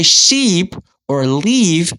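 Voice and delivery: "sheep" and "leave" with a drawn-out ee; long ee. Shown as a demonstration. Teacher contrasting with ship and live, long ee in "sheep", "leave". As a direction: stretch the vowel in "sheep" and "leave". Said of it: "that would be a totally different word".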